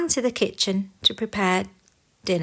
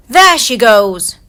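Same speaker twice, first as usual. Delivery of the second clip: much louder call; shout; 2 semitones higher, 11 dB louder than usual. Acted. Grumpy spotting the fleeing queen, loud shout. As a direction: yell out to chase. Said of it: "cried Grumpy".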